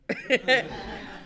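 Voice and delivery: short breathy burst of laughter; embarrassed laugh. Real, not acted. The speaker laughs at a guy's remark about her reputation, with an embarrassed laugh. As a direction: laugh it off, caught out.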